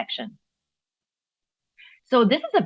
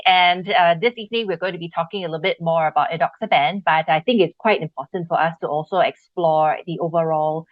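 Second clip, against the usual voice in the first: usual speaking voice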